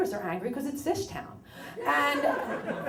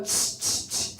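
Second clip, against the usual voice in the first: tongue clicks at dog; slower than usual. Retold or imitated. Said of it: "So I go".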